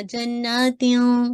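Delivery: जन्नातिन stretched, not heavy; no heavy nasal on जन्नातिन; light ikhfa. Shown as a demonstration. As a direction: keep the न of जन्नातिन light and held. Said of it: "आपने heavy नहीं करना".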